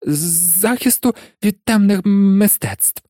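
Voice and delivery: reluctant high-pitched stammer; nervous mumble, as if avoiding the subject; Quirrell. A narrator's reading. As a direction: stutter it out unwillingly. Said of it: "пробурмотів неохоче професор Квірел".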